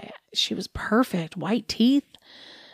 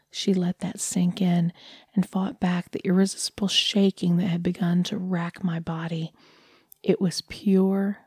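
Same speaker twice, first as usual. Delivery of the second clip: usual speaking voice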